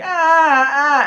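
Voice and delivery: high, loud wailing "ah"; mock scream. Imitating her scream in the story.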